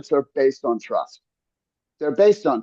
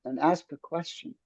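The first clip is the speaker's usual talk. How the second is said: calm soft voice; 10 dB quieter than usual. Shown as a demonstration. Coach showing how to ask calmly. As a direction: soothe the tension out of it. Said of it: "keep your voice calm".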